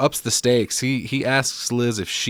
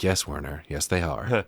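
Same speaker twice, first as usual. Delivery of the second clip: hushed reply; 7 semitones lower, 7 dB quieter than usual. Retold or imitated. Voices Liz answering Werner quietly.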